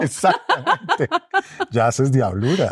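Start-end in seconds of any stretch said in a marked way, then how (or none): 0.0-1.1 laughing it out, high and slow; laughing "Exactamente" high and slow. Real, not acted. (se ríe mientras dice "Exactamente", agudo y lento)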